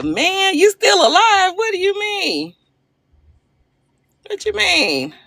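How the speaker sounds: high-pitched, mock-incredulous protest; playful disbelief. Real, not acted. Livestreamer answering a viewer on partying when old, playfully incredulous. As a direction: exclaim it high, as if you can't believe the question.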